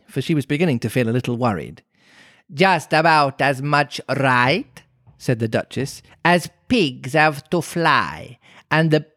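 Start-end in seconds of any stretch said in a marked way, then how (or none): 2.5-4.8 very slow, put-on character voice; drawn-out Duchess retort. A narrator's reading. Duchess retorting to Alice in story. 6.2-9.0 very slow, higher character voice; drawn-out Duchess, cut short; retorting Duchess. A narrator's reading.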